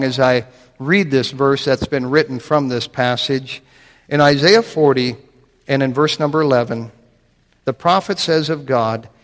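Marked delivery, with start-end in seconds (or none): none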